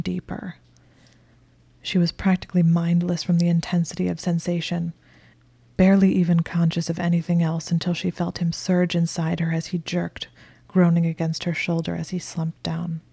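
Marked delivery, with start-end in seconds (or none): none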